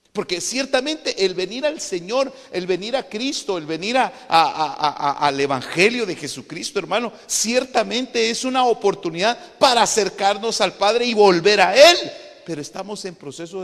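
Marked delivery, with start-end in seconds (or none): 0.1-12.0 loud, high, driving preacher delivery; full-force preaching. Real, not acted. Preacher urges the return to the Father, loud and high. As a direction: proclaim it loudly to the congregation.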